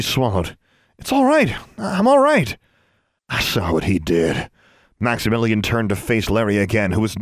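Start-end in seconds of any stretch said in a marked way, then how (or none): 1.0-2.6 high, tense insistence; shaken reassurance. A narrator's reading. Girl near tears reassures her rescuer, shaken. 3.3-4.5 low, contained anger; grim. A narrator's reading. Rescuer answers the shaken girl, grimly angry.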